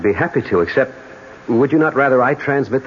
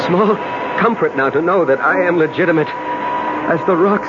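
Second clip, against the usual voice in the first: narration climbing in pitch to a climax; dramatic story narration; 4 semitones higher than usual. Acted. Man narrates his plunge to death, mounting intensity. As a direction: build steadily towards the final words.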